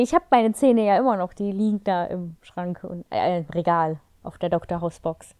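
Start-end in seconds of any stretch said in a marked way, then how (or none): none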